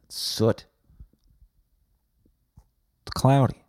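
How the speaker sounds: usual speaking voice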